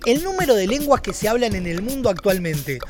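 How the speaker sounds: informative report read. Acted. Answering Juan's question about world languages, documentary narrator read. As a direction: read it as a measured factual report. Reads the researched answer to Juan.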